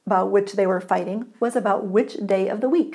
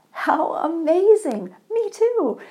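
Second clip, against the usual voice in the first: thrilled; 8 semitones higher, 5 dB louder, slower than usual. Acted. Overjoyed man, each match.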